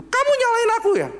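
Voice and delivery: shrill high wife throwing blame back; indignant wife. Acted. Wife blaming husband for child's faith, high and indignant. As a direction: squeal it up high, offended.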